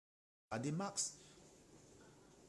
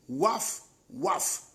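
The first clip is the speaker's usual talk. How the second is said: loud, high, sneering woof woof; barked mockery; mocking dog bark; 11 semitones higher, 5 dB louder than usual. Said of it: "comme un chien".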